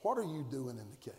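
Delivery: low, soft, probing question; hushed question. Shown as a demonstration. Preacher softly asking the congregation the big question. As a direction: ask it quietly, letting it sink in. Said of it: "there's a question that's monumental".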